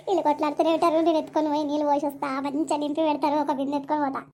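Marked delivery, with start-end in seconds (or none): none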